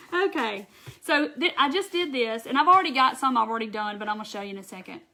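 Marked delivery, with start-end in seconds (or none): none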